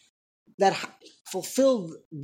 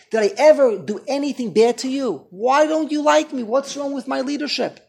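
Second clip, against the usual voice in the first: loud, higher plea; hurt tirade; 5 semitones higher, 7 dB louder than usual. Retold or imitated. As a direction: demand to know why they reject him. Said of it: "into a tirade".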